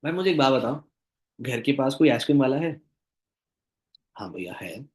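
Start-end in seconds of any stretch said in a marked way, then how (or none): 4.2-4.8 very soft, low acted answer; subdued prospect reply. Prospect answering the coach about the ice-cream seller, quietly subdued. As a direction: drop to a small, quiet reply.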